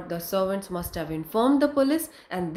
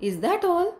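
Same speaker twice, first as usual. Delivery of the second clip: relieved surprise; 8 semitones higher than usual. A narrator's reading. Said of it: "Hana breathed".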